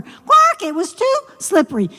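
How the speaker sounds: loud high croak, then quick whine; high-pitched, peevish frog; complaining frog. Acted. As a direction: grumble like a fussy frog. Frog's complaints retold in frog voice.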